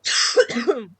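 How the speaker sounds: loud sharp chesty cough; one hard chesty cough. Real, not acted. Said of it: "My nose and my throat are not working".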